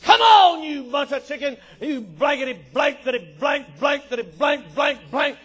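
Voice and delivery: loud taunting opener settling into mock cursing; holler, then rattle off censored insults; shouted challenge running into comic swearing; Goliath. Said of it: "I'd be Goliath".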